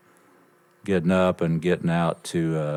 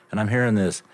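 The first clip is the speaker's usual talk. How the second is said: ordinary delivery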